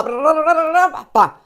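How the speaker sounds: loud rattling run of nonsense syllables; frenzied vocal chopping effect; imitated frantic chopping noise. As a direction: mimic a knife hammering away, ending on a bang.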